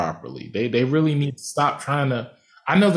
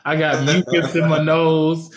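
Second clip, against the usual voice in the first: ordinary delivery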